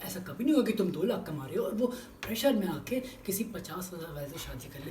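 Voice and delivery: ordinary delivery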